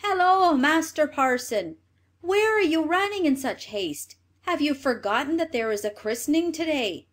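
high, loud hail and questioning; cried out. A narrator's reading. Clerk to the parson running after the girls, crying out in wonder. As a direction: call out high to someone running off. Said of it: "cried out".

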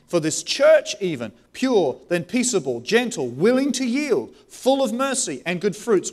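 loud, rising preacher's challenge; preaching at full force. Real, not acted. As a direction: press the question on the congregation.

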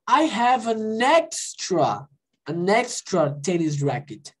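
slow, careful English line; teacher reading a dialogue line slowly; slow model. Shown as a demonstration. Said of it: "quando ele falou o quê".

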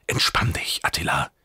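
whispering self-reassurance; hushed whisper under panic; whispered to himself. A narrator's reading. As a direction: whisper it to calm yourself down. Calming himself while drowning.

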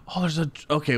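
ordinary talk, nothing marked

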